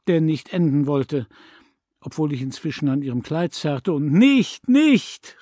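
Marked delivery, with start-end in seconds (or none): 4.1-5.4 high, loud pleading; boy tugging at his mother, crying out. A narrator's reading. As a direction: cry out to stop her.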